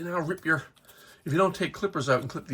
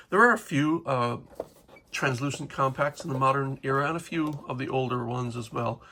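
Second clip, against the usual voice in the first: ordinary talk, nothing marked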